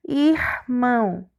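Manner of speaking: slow, two separate syllables; split into syllables. Shown as a demonstration. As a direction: part "irmão" between R and M. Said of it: "em sílabas diferentes". (devagar, separando as sílabas)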